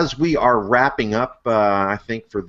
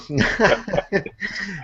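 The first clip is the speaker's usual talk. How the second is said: laugh; 10 semitones higher, 3 dB quieter than usual. Real, not acted. Laughs at own bad accent.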